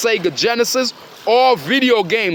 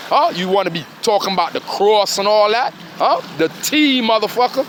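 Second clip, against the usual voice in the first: ordinary delivery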